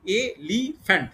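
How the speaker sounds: Elephant in three slow beats, এ, লি, ফ্যান্ট; slow, chopped elephant, syllable by syllable; split into syllables. Shown as a demonstration. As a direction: count out all three syllables of elephant, pausing between them. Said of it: "তিনটে".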